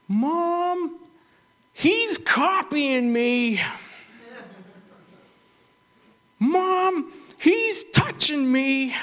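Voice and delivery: put-on high, drawn-out whiny kid; whiny tattling little sibling. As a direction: tattle to mom in a slow whine. Kids bickering in back seat.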